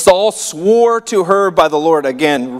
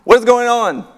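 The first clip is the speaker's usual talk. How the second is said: hammed-up startled cry, pitched way up; mock scream; 8 semitones higher, slower than usual. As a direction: play a startled scream, then a high-pitched question. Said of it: "She screams like".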